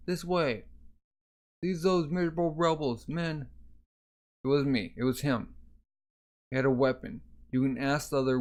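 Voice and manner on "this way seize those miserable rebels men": high-pitched acted order; rally the men with a raised pitch; commanding call. Leader sending men after the assailants, commanding.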